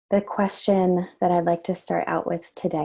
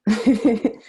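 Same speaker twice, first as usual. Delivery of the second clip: loud, higher-pitched burst of laughter; 5 semitones higher, 8 dB louder than usual. Real, not acted. Glad the children are with them.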